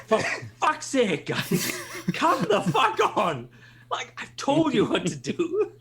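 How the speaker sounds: high, drawn-out comic pleading; exasperated. Retold or imitated. Leader who already told the guys what to do, exasperated. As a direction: let the patience snap, half laughing. Voicing leader losing patience with team.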